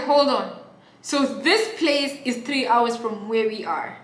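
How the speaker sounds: high, slow, stretched-out wail; loud drawn-out protest over the drive; loud refusal. Real, not acted. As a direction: cry out that you won't drive through the jungle. Disbelief at three hours.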